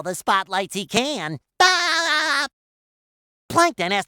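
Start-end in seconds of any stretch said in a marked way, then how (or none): 1.6-2.5 high, held "aah" scream; cartoon scream. Character in Plankton's spotlight level, high scream. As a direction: let out a drawn-out yell on one pitch.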